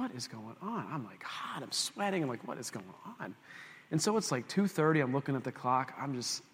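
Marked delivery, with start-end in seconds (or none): 0.0-0.9 quiet, low bewildered grumble; hushed exasperation. Retold or imitated. Preacher retelling his sleepless night, hushed exasperation. 2.5-3.4 quiet, low bewildered grumble; hushed exasperation. Retold or imitated. Preacher retelling his sleepless night, hushed exasperation.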